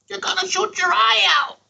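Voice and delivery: hammed-up cry of a worried mom; movie quote yell. Quoting a famous movie line as a hammed-up high shout.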